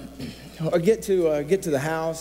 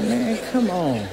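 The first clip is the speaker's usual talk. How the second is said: high, slow mock exasperation; exasperated; 5 semitones higher, much slower than usual. To the man ordering double steak, slow high exasperation. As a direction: drag it out, comically fed up.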